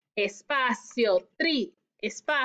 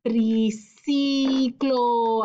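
"Triciclo" drawn out very slowly; stretched-out word; 3 semitones lower, much slower than usual. Shown as a demonstration. Teacher stretching the word for children, slowly. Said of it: "Hay que estirar la palabra".

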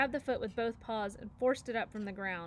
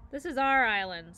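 louder, snarling bear threat; snarled; 8 dB louder than usual. A narrator's reading. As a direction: snarl it to claim the territory. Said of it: "snarled Nettle".

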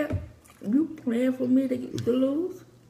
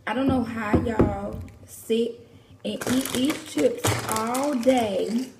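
usual speaking voice

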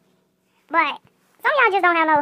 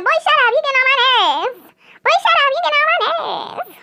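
exaggerated mock telling-off; mocking imitation; 9 semitones higher, 3 dB louder than usual. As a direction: mimic her high and loud, stretching the last words. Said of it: "She said it like that, y'all".

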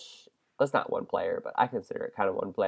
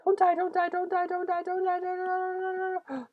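anxious pleading; 3 dB quieter than usual. Real, not acted. Pleading in game.

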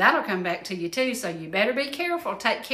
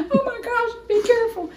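much higher, loud cry of alarm; startled warning to be careful; 13 semitones higher, 4 dB louder than usual. Real, not acted. Warning the crew about the hot dish, high and alarmed.

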